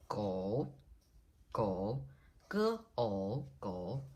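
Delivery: "go" low, dipping then rising; falling-rising third tone. Shown as a demonstration. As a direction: say "go" with a low scoop, split once into "ge, o". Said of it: "so the falling rising tone".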